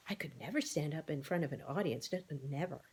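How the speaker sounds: shy quiet protest; timid. A narrator's reading. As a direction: shrink from the very idea. Shy Alice recoils from speeches.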